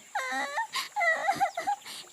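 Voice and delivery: very high acted bawling; high-pitched wail. Little sister hit on the head, wailing. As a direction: cry out like a hurt child. Hurt little bird bawls.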